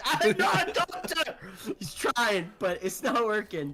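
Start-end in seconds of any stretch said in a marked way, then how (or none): 0.1-1.4 high, frantic protest; high panicked character voice. Acted. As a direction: cry it out while packing wounds.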